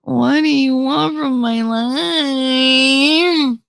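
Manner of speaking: very slow, high mock wail; hammed-up tearful Jersey exasperation; exasperated mom whine. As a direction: whine and stretch it out, driven crazy. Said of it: "That's when you hear me go".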